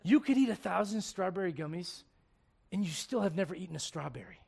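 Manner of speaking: ordinary delivery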